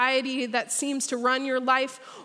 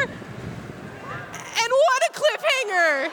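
mock dismay; 10 semitones higher, much slower than usual. Real, not acted. Ride clip cuts off.

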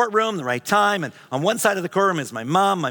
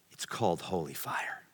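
whispered; 12 semitones lower, 14 dB quieter than usual. Real, not acted. Preacher's climactic reverent line.

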